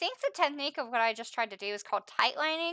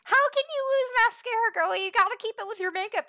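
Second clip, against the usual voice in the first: higher, playful scolding; teasing; 13 semitones higher, 5 dB louder than usual. Real, not acted. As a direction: tease her like a big sister. Teasing friend about mascara.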